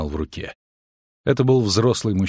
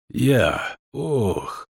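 hurt gasp breaking into a cry; pained groan; 3 semitones higher than usual. A narrator's reading. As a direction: cry out through the pain. Wounded man cries out. (стон от боли)

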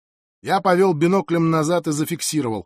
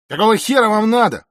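loud, raised-pitch demand; curt angry retort; 7 semitones higher, 8 dB louder than usual. A narrator's reading. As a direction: cut him off with a blunt challenge. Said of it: "отрезал Рики". (резко, с вызовом)

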